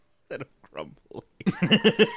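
slow, amused whisper; whispered mock echo. Real, not acted. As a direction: hush it like a joke. Mocking repeat of phrase.

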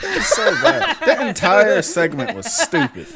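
high, loud, helpless laughter through the words; laughing rant. Real, not acted. Mocking a stupid movie scene with friends, laughing rant. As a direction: cry it out while cracking up. Cracking up over the bad scene.

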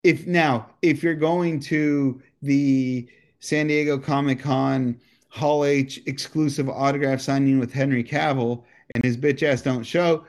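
normal talking voice

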